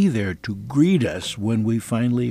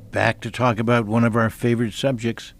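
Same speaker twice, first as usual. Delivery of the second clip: usual speaking voice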